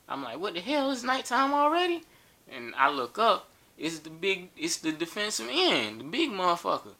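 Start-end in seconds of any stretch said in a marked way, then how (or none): 0.4-2.0 high, startled what the hell; retold surprise. Comedian retelling the sun vanishing, startled. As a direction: caught off guard as the light vanishes.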